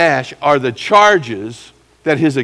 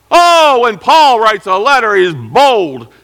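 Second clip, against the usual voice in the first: high, loud mocking voice of the accusers; 9 semitones higher, 7 dB louder, slower than usual. Accusers mocking Paul's bold letters, high and loud. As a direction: sneer out their charge like a gossip.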